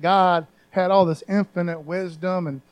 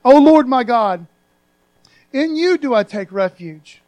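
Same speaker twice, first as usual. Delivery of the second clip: serious cry; 3 semitones higher, 11 dB louder than usual. Acted. Preacher acting out David's earnest loud cry for refuge. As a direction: lean into it like a desperate prayer. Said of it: "He is so serious about this".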